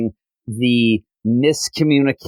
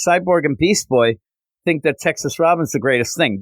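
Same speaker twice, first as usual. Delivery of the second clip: usual speaking voice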